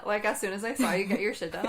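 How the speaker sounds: ordinary talk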